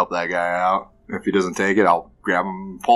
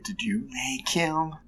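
whispering; 9 semitones higher, 7 dB quieter, slower than usual. Acted. Suspicious quiet questioning.

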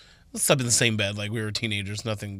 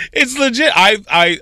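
ordinary talk

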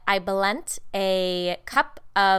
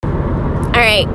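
ordinary talk

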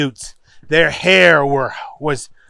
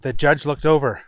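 ordinary delivery, nothing marked

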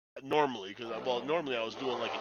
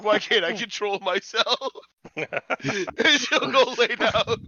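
laughing; 8 semitones higher, 8 dB louder, slower than usual. Retold or imitated. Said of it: "It's like".

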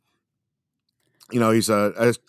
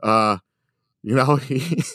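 ordinary delivery

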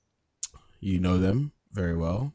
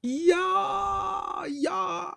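squeaky high yeah. Real, not acted. Reacting to compliment.

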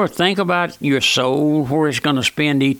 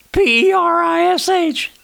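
high, level sing-out of each letter; letters called out high and evenly; emphatic spelling; 13 semitones higher than usual. Shown as a demonstration. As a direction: spell it out on one high held pitch.

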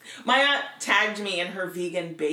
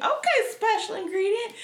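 excited Tabitha; 12 semitones higher than usual. Retold or imitated. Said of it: "Tabitha reply".

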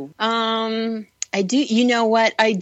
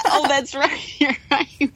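laughing through the words, pitched up; 8 semitones higher than usual. Real, not acted. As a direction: giggle while agreeing. Laughing at the pizza joke.

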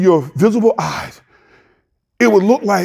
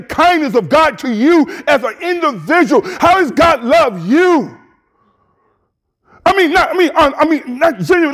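raised, higher preaching holler; preacher hollering; 7 semitones higher than usual. Real, not acted. Pastor hollers the question at his congregation, higher. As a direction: lift the voice and holler it out. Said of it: "Let me stop hollering".

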